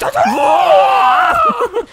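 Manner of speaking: high shouted zombie groan; zombie noise. Retold or imitated. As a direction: cry out like a zombie. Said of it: "She's making zombie noises".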